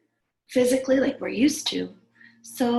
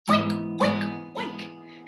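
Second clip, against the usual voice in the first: pig oinks; much slower than usual. Shown as a demonstration. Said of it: "let's sing it".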